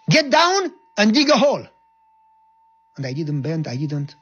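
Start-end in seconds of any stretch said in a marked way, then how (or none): 0.1-1.7 high, loud command; shouted order. Retold or imitated. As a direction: bark the order at him. Captain ordering in front of everyone.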